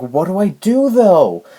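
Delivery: high, loud, bewildered mock plea; exasperated plea. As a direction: raise it into a helpless retold question. Retelling her helpless question to teacher.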